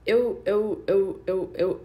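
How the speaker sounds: loud, flustered stammer repeating eu; stammering apprentice. Acted. Apprentice put on the spot by his employer, stammering loudly. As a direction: apprentice caught off guard, stuttering to his boss.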